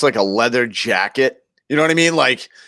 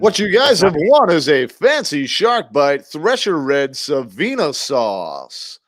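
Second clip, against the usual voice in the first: mock game-show announcer, raised pitch; prize announcer; 3 semitones higher, slower than usual. Co-host reveals listeners' prize, game-show announcer voice. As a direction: announce it big, stretching the ending.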